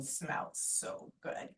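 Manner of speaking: breathy hushed sigh of pleasure; savoring whisper. Retold or imitated. Husband savoring his coffee, hushed whisper. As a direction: savor it under your breath.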